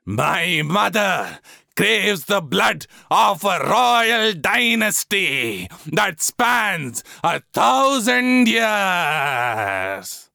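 very slow, drawn-out, otherworldly demand; the goddess speaking through him; possessed oracle. A narrator's reading. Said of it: "catastrophe coloring his voice".

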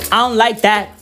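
usual speaking voice

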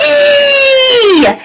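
long, high drawn-out call; yell. A narrator's reading. Max calls to his friend Froggy, drawn-out yell. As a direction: holler the name, stretching both syllables. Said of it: "yelled his friend Max".

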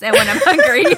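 laughing through the words. Real, not acted. Admitting she gets hungry, said laughing.

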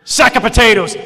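loud, clipped mock put-down; blunt bark. Seminar speaker shouting down the crying woman. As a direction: bark the phrase at her.

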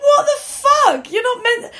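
reliving the shock of hearing where the cannabis went; mock shocked outburst. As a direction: squeal it out in mock horror, half laughing. Shocked at parents' giveaway.

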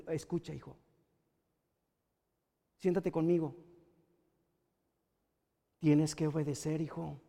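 very soft, low voice; sit the child down and speak quietly; gentle parental talk. Acted. Parent asking a son to listen and obey, gently. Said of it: "le dijiste".

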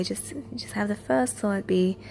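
ordinary talk, nothing marked

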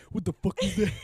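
high, loud imitation through laughter; mock panicked shriek. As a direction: imitate a friend's panic, cracking up. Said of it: "You're like".

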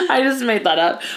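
ordinary delivery

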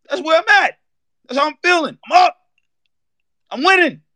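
high, shouting out his winning mood; hyped bragging. Acted. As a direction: crow it like the champ. Celebrating expensive tequila.

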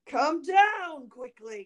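cried command. A narrator's reading. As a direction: call impatiently up the stairs. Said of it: "cried Bluebeard".

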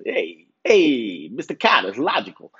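a hint of a pushy guy saying "it's logical"; repeating the funny voice to Mister Carter on purpose; lightly imitated Vinnie Bobber. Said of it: "I sounded like Vinnie Bobber".

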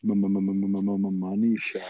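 stuttered build-up. Pretend. Stuttered joke punchline.

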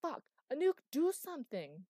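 loud, high desperate yell; panicked shout. Acted. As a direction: scream for help as the vine squeezes. Panicked command under attack.

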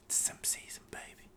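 whispering. Pretend. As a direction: hush it like a cheeky slogan. Whispered catchphrase plug.

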